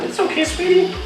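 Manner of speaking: high, soft coo to a cranky snake; soothing pet talk. Real, not acted. Keeper soothing the hissing cobra, in a high soft coo.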